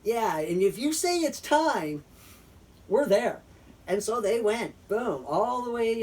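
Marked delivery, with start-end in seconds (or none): 0.0-3.4 eager insistence. Retold or imitated. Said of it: "They're like".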